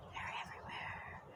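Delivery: very soft whisper; hushed aside. Pretend. Woman confiding about spirits she saw, hushed whisper.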